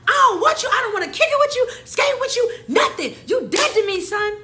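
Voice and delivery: very high, loud dramatic tirade; re-enacted hysterical yelling, over the top; freaking out. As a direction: shout a hammed-up furious breakup. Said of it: "I'm freaking out".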